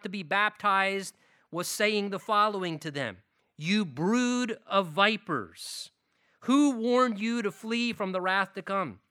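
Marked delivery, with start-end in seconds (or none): 3.6-9.0 loud, slow prophetic rebuke; fiery preaching. Acted. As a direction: thunder it like John the Baptist. Voicing John the Baptist's rebuke.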